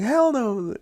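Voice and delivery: the buddy insists he had no chance; emphatic denial. Retold or imitated. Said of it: "He's like".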